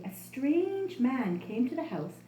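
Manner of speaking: ordinary delivery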